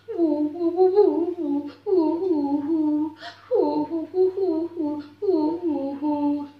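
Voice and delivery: high sing-song crying woo; sobbing woo. Acted. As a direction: cry like a little bird. Bird crying sounds.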